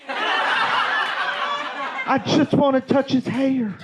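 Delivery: slow, high dreamy swoon; swooning aunt. Retold or imitated. Aunt swooning over [name]'s hair, slowed right down. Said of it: "And she said".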